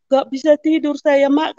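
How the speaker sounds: ordinary talk, nothing marked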